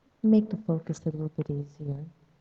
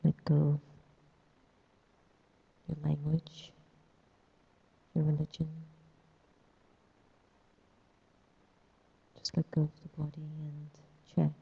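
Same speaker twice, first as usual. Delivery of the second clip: guided meditation. Acted. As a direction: ease them into stillness.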